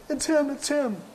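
high-pitched, urgent imitation of frightened girls; hammed-up panicky tip-off; mock alarm. Office girls alerting Skip to the caller, mock alarmed.

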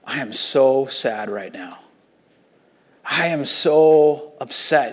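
low and slow, heavy with grief; Jesus speaking inwardly, slow and sorrowful; voicing Jesus' sorrow. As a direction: let each word drag with sadness. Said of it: "he's going in his heart".

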